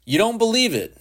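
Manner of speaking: very high, taunting jab; high-pitched taunt. A narrator's reading. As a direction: goad him with a high, swooping voice. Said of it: "His face was a taunt".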